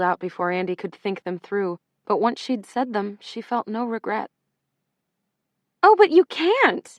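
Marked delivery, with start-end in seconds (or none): 5.8-6.9 sudden high panicked plea, drawn out. Acted. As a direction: let panic break through, slow and high. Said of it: "the very first notes of panic".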